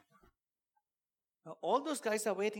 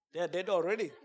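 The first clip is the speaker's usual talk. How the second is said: ordinary talk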